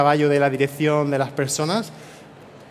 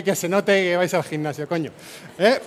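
ordinary delivery

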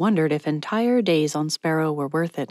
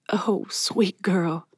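slow, whispery and moved; tearful tenderness; much slower than usual. Acted. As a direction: murmur it tenderly, close to tears. Patty moved to tears greets Mallory.

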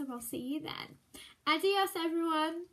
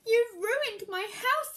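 higher, louder, slow witch wail; sad witch; 7 semitones higher, 7 dB louder, slower than usual. Acted. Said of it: "said the witch, feeling sad".

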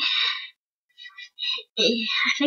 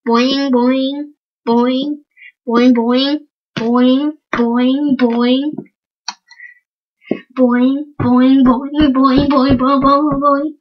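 loud boing sounds; 8 dB louder, much slower than usual. Acted. Loud repeated bouncing noise.

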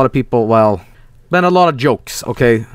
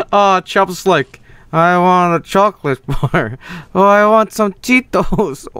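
zombie voice; 5 semitones higher, slower than usual. Pretend. Voicing the zombie's cravings.

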